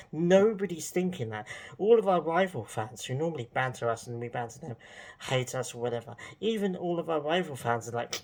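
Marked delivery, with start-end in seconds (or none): none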